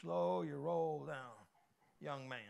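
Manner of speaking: measured dad-to-suitor warning; warning father. Acted. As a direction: quietly put the young man on notice. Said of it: "dating your daughter walks through the door".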